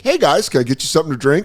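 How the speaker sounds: put-on customer-service lilt; waiter's greeting. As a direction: greet a table as their server. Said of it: "it's called the first approach".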